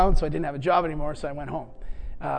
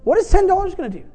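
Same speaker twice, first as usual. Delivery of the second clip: exasperated complaint; 8 semitones higher, 4 dB louder than usual. Retold or imitated. Retelling his own complaint to God.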